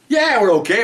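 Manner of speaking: high, exasperated retold comeback; indignant retort. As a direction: imitate an irritated woman answering back. Angry women answer the officer back.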